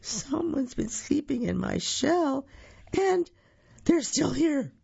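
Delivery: put-on high, squeaky baby crocodile; tiny little voice. A narrator's reading. Baby crocodile finds intruders in his shell, tiny high voice. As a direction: pipe up like a tiny creature. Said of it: "a tiny little voice say".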